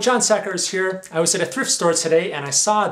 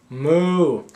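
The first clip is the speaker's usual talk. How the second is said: cow moo. Pretend. Cow noises in a picture book.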